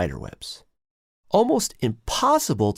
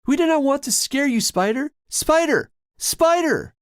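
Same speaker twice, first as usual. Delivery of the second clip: high, loud calling out; 4 dB louder than usual. A narrator's reading. Two brothers calling for the spider, loud. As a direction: call out across the room. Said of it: "call out".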